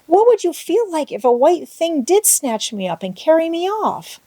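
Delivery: put-on frightened girl begging her guardian, sobbing; sobbing plea. A narrator's reading. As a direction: beg through tears. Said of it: "sobbed Anne".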